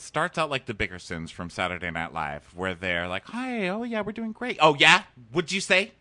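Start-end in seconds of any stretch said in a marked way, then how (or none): 3.3-4.6 very high chirpy put-on voice; mock happy couple. Couple in a sketch feigning happiness. 4.6-5.9 high, confrontational challenge; mock picking a fight. Sketch couple starts a fight.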